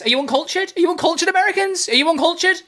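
high, hammed-up scolding of Americans; mock indignant. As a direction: play the outraged snob, pitch climbing.

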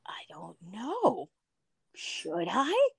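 whispered, coy hesitation; coy unsure whisper. Retold or imitated. Answering family's big question, coy and whispered. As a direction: tease them with a shy maybe.

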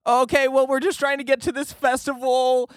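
very high, put-on innocent voice; mock nervous pleading. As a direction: plead it high-pitched, like caught kids explaining. Acted pleading reply to the stranger.

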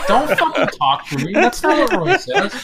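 high, breaking into laughter; laughing. Real, not acted. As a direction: say it through laughter. Laughing reply to butt joke.

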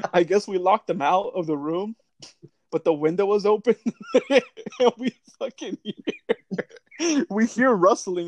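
real laughter breaking into the words; laughing while telling. Real, not acted. Recalling locking a friend out, laughing through the words. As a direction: struggle to tell it through laughter.

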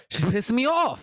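put-on high little boy voice, fed up; imitating angry kid. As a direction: play the grumpy toddler. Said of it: "I'm like".

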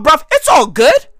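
ordinary talk, nothing marked

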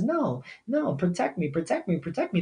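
small, soft pleading inner voice; little tiny voice. Acted. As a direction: softly mimic a timid voice in the head. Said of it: "little tiny voice in their head".

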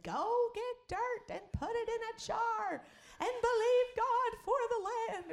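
high, slow, otherworldly instruction; lightly hammed-up prophecy; prophetess voice. Imitating the prophetess's call about the jar, high and slow. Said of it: "she called and said".

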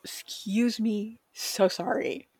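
soft, slow whispered apology. Real, not acted. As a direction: whisper it sheepishly. Apologising after burp.

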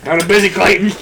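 busy bartender brush-off. Retold or imitated. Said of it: "And you would just hear".

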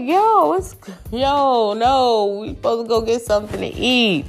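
high, rising alarm, pitch falling as it goes; startled protest. Retold or imitated. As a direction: push him off, high and urgent. Refusing his kiss, calling out.

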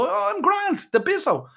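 squeaky high insistence that all is fine; forced cheerful denial. Acted. Sick boy brushing off his worried friend, strained high. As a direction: jump way up in pitch to fake being fine.

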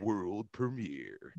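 very slow, drawn-out trailer announcer; movie trailer voice. Shown as a demonstration. Asked to repeat it, very slow trailer announcer. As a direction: savour each word like an epic trailer. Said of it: "Say that again".

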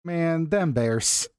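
slow, high Chicago fan lament; hammed-up Chicago Bears fan; Chicago accent. Shown as a demonstration. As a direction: drag out the Chicago vowels. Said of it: "has the best Chicago accent".

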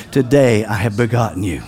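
low, hushed voice of God; deep, measured divine pronouncement; God speaking. Acted. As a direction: declare it low and solemnly to your children. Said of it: "and he was saying".

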